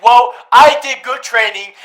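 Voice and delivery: mock proud trainee. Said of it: "the dude's like".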